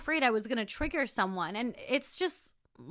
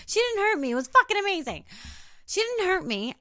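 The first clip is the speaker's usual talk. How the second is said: much higher and louder, gushing; emphatic reassurance; 9 semitones higher, 5 dB louder than usual. Retold or imitated. Reassuring her partner, excited and emphatic. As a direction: insist it was wonderful. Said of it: "I'm like".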